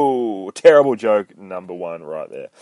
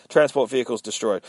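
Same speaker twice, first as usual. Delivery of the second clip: ordinary delivery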